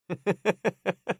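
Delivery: high, rapid giggling laugh; amused chuckle. Acted. Amused at finding the treasure.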